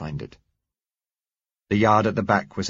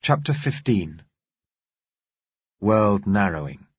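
slow, measured announcing; chapter heading; slower than usual. A narrator's reading. Narrator announcing a new chapter, slow. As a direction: read the title slowly.

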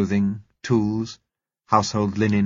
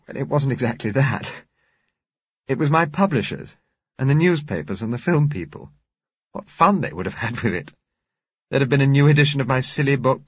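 higher, quicker, amused Josella; lively Josella; 6 semitones higher, 3 dB louder than usual. A narrator's reading. Josella recalling wedding publicity to Bill, amused. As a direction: rattle it off with mock horror. Josella's lines voiced by narrator.

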